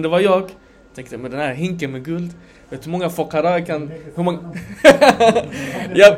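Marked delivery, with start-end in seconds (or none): 4.4-5.9 a light bright laugh; sheepish laugh at the gold; short laugh. Real, not acted. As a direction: laugh at yourself. Laughs at own greed.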